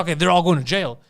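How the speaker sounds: normal talking voice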